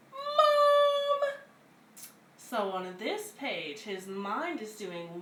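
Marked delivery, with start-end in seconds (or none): 0.1-1.3 put-on high, loud child wailing for mom; high shrill-free loud child's cry for mom; whiny kid call. Child calling for mother.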